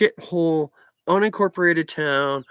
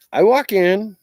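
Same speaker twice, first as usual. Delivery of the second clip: loud; 6 semitones higher, 7 dB louder, slower than usual. Real, not acted. Story climax begins.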